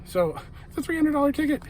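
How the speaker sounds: can't believe her luck, voice soaring; breathless, gushing fan after the show; real excitement. Real, not acted. Fan gushing over free ticket.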